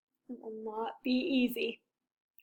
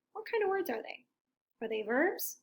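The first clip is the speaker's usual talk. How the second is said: ordinary delivery, nothing marked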